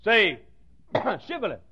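a hint of the stern guard at the river; quiet guard challenge. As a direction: demand it quietly. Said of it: "and I say".

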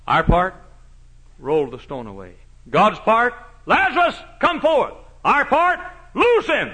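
high, loud preacher's cry; fiery preaching. Acted. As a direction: hammer it home from the pulpit. Preaching at full force.

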